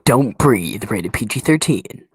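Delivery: big booming trailer announcer; movie trailer guy voice. Pretend. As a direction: sell it like a horror movie trailer. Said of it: "your best movie trailer guy voice".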